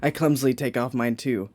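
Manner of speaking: low, soft reading voice; story narration. Acted. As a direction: read it out low and steady.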